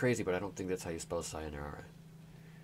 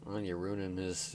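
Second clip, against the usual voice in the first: normal talking voice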